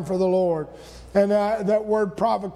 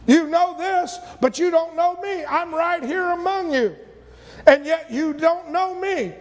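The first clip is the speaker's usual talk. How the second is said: Jesus rebuking harder; 7 semitones higher than usual. Retold or imitated. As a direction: press it home, rising in intensity. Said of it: "He said".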